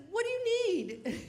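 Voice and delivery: high, laughing, nervous ask; nervous laugh, asking a stranger at the door; laughing nervous question. Retold or imitated. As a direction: squeak it out through laughter.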